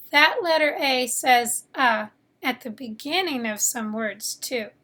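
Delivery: ordinary delivery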